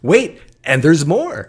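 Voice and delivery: loud, high, slow pitchman parody; mock infomercial. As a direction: sell it like a spoof infomercial.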